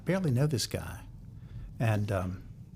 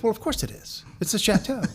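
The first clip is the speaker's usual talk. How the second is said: lightly mocking, higher, matter-of-fact; of course; 10 semitones higher than usual. Manager correcting the naive publisher, higher amused matter-of-fact. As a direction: toss it off as if it's obvious. Miles answers a naive question.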